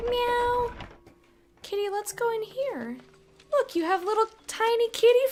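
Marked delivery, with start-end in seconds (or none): 0.1-0.7 cat meow. Retold or imitated. Meowing at the cat.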